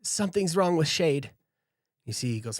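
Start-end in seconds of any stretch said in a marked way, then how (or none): 0.0-1.3 higher and louder, urgent alarm; urgent call. Acted. Foxtail calling Marcus in alarm, loud and urgent. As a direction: call him over fast, something's wrong.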